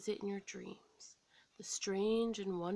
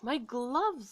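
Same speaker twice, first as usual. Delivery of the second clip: louder, firm insistence; 4 semitones higher, 6 dB louder than usual. A narrator's reading. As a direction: put your foot down.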